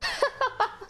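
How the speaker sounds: high, short burst of giggles; amused giggle. Real, not acted. Streamer teasing John about shopping with girls, amused giggle. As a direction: giggle at the question just read. Reading game dialogue, laughs.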